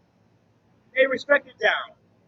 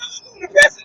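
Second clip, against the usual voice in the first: mocking echo; 11 semitones higher, 7 dB louder than usual. Mimicking own line back, mentor.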